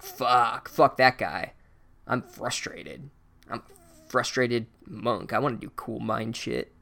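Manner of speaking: low, soft, slow grumbling monk; a sulky monk quietly venting; frustrated Buddhist monk. Acted. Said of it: "Buddhist monk impression".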